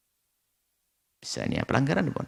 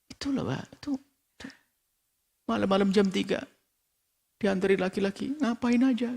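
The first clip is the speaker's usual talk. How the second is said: high, hushed tattling voice; gossiping spouse; 10 semitones higher than usual. Acted. Spouse gossiping about the neighbour's daughter, high scandalised tattling. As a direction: pitch it high and confide the scandal.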